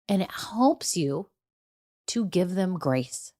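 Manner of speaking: soft, slow whisper. Real, not acted. Coach urging listeners to give grace, whispered. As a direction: drop to a whisper to land it.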